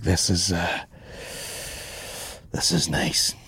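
soft, low murmur, trailing into breath; hushed contentment. Retold or imitated. Recalling standing there in nice weather, hushed and content. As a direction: let it out quietly, taking in the view.

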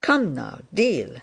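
usual speaking voice

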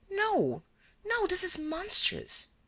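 high, slow, horrified no; horrified denial. A narrator's reading. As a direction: recoil from the accusation. Girl denies the murder.

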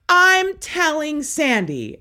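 high, slow, drawn-out threat; re-enacted threat, stretched out high; tattling threat. Daughter threatens to tell dad's girlfriend, high taunting threat. Retold threat during family fight.

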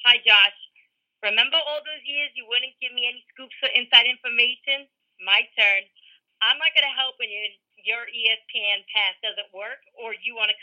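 put-on tweet read in a thick Bronx mom accent. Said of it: "read your last tweet in that accent".

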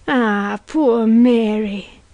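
long, drawn-out lamenting cry; slow, keening condolence; crying out in pity. A narrator's reading. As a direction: wail with sympathy. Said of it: "she cried".

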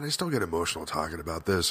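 real emotion welling up while speaking; teary. Real, not acted. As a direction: voice thick with held-back tears. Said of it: "I'm getting teary right now".

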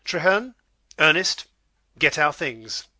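a lord gathering his family, dignified; wrapped in dignity. Acted. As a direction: withdraw with injured dignity. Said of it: "Wrapping himself in his dignity".

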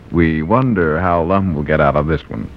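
ordinary talk, nothing marked

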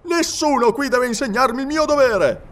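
high, loud offended retort; indignant captain. Acted. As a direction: put him in his place. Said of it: "rispose il capitano". (il capitano ribatte indignato, ad alta voce)

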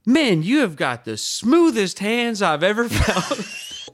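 put-on a slow, admiring good old boy at the bar; impressed good old boy. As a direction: draw it out like an amused local. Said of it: "he goes".

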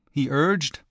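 plain reading voice

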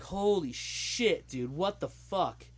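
drawn-out, astonished exclamation; retelling stunned disbelief; retold shock. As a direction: start high and slow, then let it drop. Retold shocked reaction to film.

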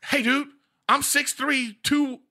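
impression of a tall guy. Comic mimicking a tall guy complaining about the car, high and slow. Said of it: "He got his voice perfect".

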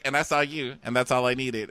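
a touch of cheesy devotion; mock Eric. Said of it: "he's like".

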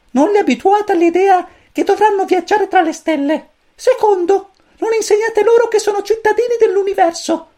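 very high, squeaky little chick voice; cosmic chick voice. A narrator's reading. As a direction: chirp it like a tiny alien chick. Pulcino cosmico elenca. (vocina acutissima da pulcino)

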